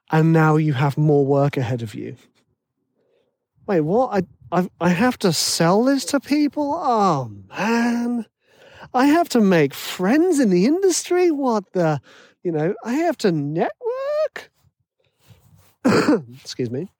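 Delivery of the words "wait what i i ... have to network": mock alarm. Realizing the work ahead.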